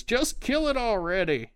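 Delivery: high, urgent panicked voice; panicked crossbowman. Acted. Crossbowman firing at the unknown thing: high panicked urging. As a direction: urge it out high-pitched. Crossbowman urges shooting the threat.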